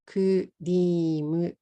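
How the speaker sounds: "kurimu" as ku-ri-mu with a tapped r; katakana English. Shown as a demonstration. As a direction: give "kurimu" a vowel after k and m. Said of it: "In katakana".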